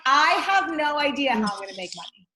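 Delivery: high, loud, fast panic about money; panicky mantra. Shown as a demonstration. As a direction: blurt it out like you're freaking out. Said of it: "it could be like".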